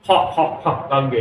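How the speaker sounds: mock English accent dropping the r, हर turned into हा. Teacher mocking English speakers' missing r to the class, hammed-up English accent. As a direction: tease the English who can't say r. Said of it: "पर कभी र नहीं बोल पाए".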